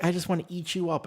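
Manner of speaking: high, doting grandma coo. Retold or imitated. Mocking a grandmother cooing at a child. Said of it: "your grandmother's like".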